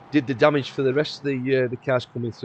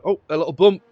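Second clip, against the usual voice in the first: sudden higher exclamation at a crash; surprised; 4 semitones higher, 5 dB louder than usual. Real, not acted. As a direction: react as the crash happens. Crash seen on replay.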